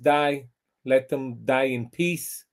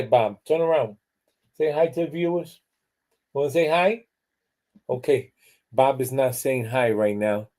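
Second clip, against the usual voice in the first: ordinary talk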